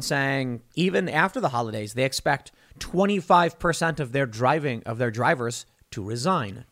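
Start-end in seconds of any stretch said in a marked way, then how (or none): none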